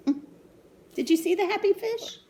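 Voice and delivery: plain reading voice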